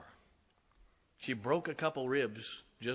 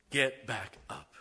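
slow, drawn-out and louder; rising preacher's call; 5 dB louder, slower than usual. Real, not acted. Preacher to his congregation, slow building call. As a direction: stretch each word as the shout builds. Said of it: "I'm about to shout".

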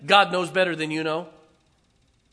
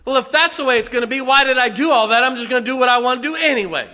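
loud, high, fast huffy retort; mock sulky defiance toward God; petulant believer; 8 semitones higher, 10 dB louder, faster than usual. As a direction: toss it off like a sulking child. Preacher voices a sulking believer.

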